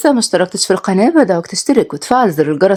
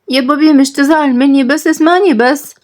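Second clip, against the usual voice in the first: higher, slow, whiny plea; pleading child; 5 semitones higher than usual. Acted. The child pleads with his father, higher and drawn out. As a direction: beg dad to listen.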